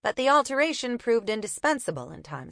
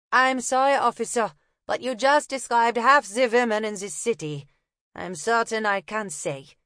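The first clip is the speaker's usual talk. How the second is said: put-on higher, German-accented apology; 3 semitones higher, 4 dB louder than usual. Una fobbing off the copper in a German accent. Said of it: "feigning a German accent".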